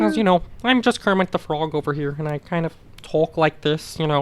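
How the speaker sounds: lightly voiced frog puppet; Kermit the Frog. Shown as a demonstration. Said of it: "I used to do Kermit pretty well".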